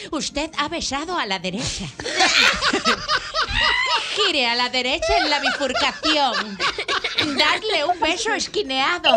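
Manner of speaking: mock sat-nav announcement, laughing; hammed-up navigation voice through laughter; GPS voice. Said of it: "le llaman el G P S". (voz de GPS)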